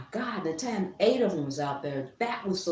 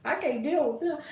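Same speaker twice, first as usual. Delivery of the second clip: laughing; 7 semitones higher than usual. Real, not acted. Laughing about the worms.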